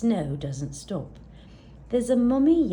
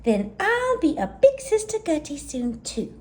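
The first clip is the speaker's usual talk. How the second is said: excited; 8 semitones higher, 4 dB louder than usual. Acted. Excited little yak, new baby.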